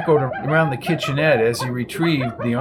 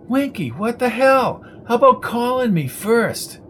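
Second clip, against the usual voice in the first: exasperated scolding; 10 semitones higher than usual. A narrator's reading. Coco scolding Winky for the late knock, exasperated. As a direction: snap at him for not calling first.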